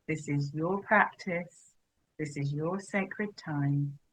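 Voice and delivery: unhurried, even, spacious delivery; guided meditation. Real, not acted. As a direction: guide them gently into settling, phrase by phrase. Leads a settling meditation.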